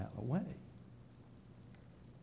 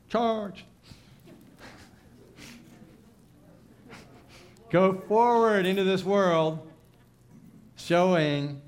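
high, loud, slow call to go forward; rousing charge; 10 semitones higher, 10 dB louder, much slower than usual. Acted. Preacher charging the standing congregation, rousing high call. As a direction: send them out like a battle cry.

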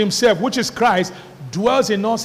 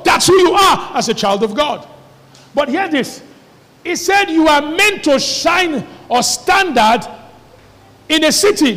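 fiery preaching; 5 semitones higher than usual. Acted. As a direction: preach it at full force. Preaching builds to a shout.

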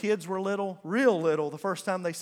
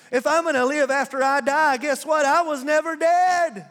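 higher and louder, a ringing declaration; triumphant exclamation; 6 semitones higher, 6 dB louder than usual. Acted. Pastor proclaiming to mourners he never died, triumphant and loud. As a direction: proclaim it like victory.

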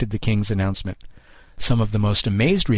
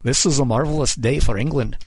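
higher, lightly pompous oratory; declaiming king; 4 semitones higher than usual. A narrator's reading. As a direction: declaim it as a monarch to the crowd. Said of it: "the king declaimed".

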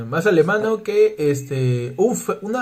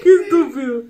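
laughing; 7 semitones higher than usual. Real, not acted. Laughing at the joke.

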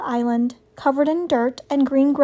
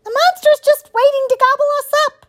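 very high, fast, loud shriek; small animal squealing in panic; panicked shriek; 6 dB louder, faster than usual. A narrator's reading. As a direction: shriek it in terror as a groundhog. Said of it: "shrieked Digdown".

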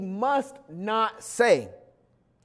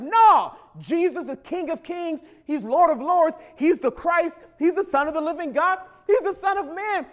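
loud, high proclamation; full-force preaching; 7 semitones higher, 4 dB louder than usual. Acted. Preacher proclaims Jesus to congregation at full force. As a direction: preach it to the rafters.

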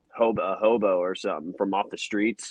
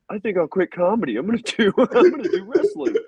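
higher and louder, breaking into a laugh; giddy, laughing announcement; excited; 9 semitones higher, 6 dB louder than usual. Retold or imitated. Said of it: "and I'm like".

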